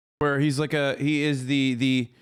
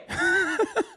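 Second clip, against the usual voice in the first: high prim laugh in the mock transatlantic voice. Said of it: "I have a transatlantic".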